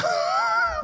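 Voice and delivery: sharp high burst of laughter; high laughing burst. Real, not acted. Rabbi laughs high at the lobster's catch.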